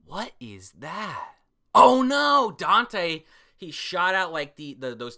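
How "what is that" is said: usual speaking voice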